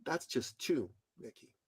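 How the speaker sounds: barely audible correction; very quietly. Retold or imitated. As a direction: drop to almost nothing. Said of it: "he so quietly said".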